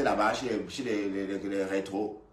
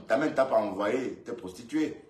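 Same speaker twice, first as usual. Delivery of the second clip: ordinary talk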